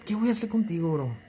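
usual speaking voice

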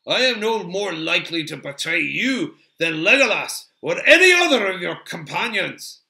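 loud, proud dwarf protest building to a shout; affronted dwarf, rising in pitch and force; indignant Gimli. A narrator's reading. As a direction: defend your honour at full voice. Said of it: "not at all to the liking of Gimli".

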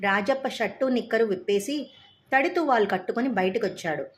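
usual speaking voice

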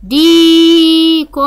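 long held ee in ديك; ديك said slowly with a sustained dee; elongated letter. Shown as a demonstration. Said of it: "حرف الدال ممدود بالياء".

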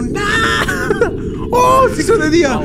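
high, drawn-out cry; frantic high-pitched yelling; panicked wail. Real, not acted. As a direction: wail in alarm. Alarmed, cannot see in game.

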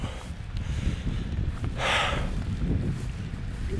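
long unvoiced sigh, letting it out; heavy sigh. Real, not acted. Vlogger mourning the poached deer, long sigh. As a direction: breathe out the grief slowly. Emotional pause about deer.

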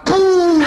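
loud high held "pum"; cartoon bang, high and long; imitated explosion. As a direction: boom it out like a big firecracker. Imitates the big firecracker exploding.

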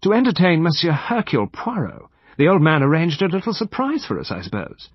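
lightly drawled, put-on society manner; affected slight drawl. A narrator's reading. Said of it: "came with a slight drawl".

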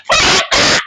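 very high cry to God; firing prayer. Retold or imitated. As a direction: cry out mid-prayer, acting the mother. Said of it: "he start firing prayer".

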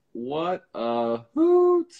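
very slow, high, savoured words; drawn-out exclamation. Real, not acted. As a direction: stretch each word, lifting the pitch on the last. Host repeats reaction, stretched further.

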